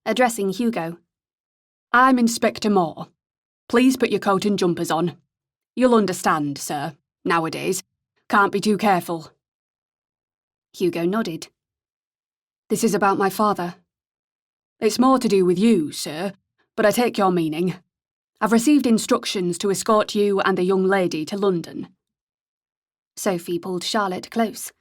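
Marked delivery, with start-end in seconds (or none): 1.9-9.3 friendly northern English inspector; relaxed northern English accent, courteous. A narrator's reading. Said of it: "in a pleasant northern accent". 12.7-21.9 put-on pleasant northern English accent; courteous northern English inspector. A narrator's reading. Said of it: "in a pleasant northern accent".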